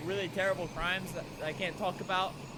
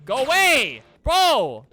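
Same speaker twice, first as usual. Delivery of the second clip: loud, higher-pitched burst; annoyed shout; 5 semitones higher, 11 dB louder, slower than usual. Real, not acted. Player chasing off a pest, annoyed shout. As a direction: yell to drive someone off.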